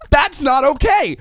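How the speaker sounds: loud, high, drawn-out protest; comic indignation, pushed high and loud; indignant outburst. Real, not acted. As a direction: raise the voice and stretch each word in outrage. Comic outrage at the translated insult.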